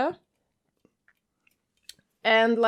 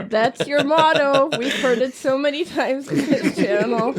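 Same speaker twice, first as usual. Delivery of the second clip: louder, talking through laughter; laughing; 3 semitones higher, 4 dB louder than usual. Real, not acted. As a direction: tease while cracking up. Teasing friend while laughing.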